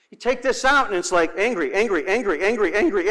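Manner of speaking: normal talking voice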